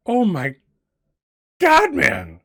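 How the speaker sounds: high, loud cry of disbelief; exasperated outburst. Acted. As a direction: burst out in acted exasperation. [name] reacts to being doubted.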